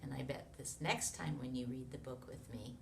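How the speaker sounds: ordinary talk, nothing marked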